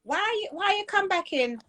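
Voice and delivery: high-pitched questioning of a patient; retold challenge. Doctor to patient who wheeled herself back, retold challenge.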